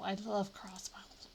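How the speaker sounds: slow, breathy whisper on "love". Real, not acted. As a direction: whisper "I love crossbows" slowly, leaning on "love". Gushing about crossbows, then repeats.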